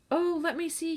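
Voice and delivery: put-on high, eager boyish cry; a boy thrilled to see the boxes; excited cry. A narrator's reading. Young boy crying out eagerly to his sister. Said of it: "he cried".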